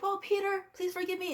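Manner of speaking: high, pleading meek voice; a pleading Norman, pitched high; meek Norman Osborn. Retold or imitated. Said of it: "he's Norman Osborn, all like".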